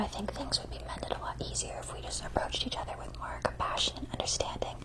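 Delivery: soft ASMR whisper. Acted. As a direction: whisper close to the mic.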